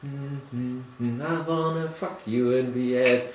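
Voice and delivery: slow, drawn-out sing-along lyric; slow nodding groove. Retold or imitated. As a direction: bob along, slowly. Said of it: "so langsam fangen die an mit Nicken".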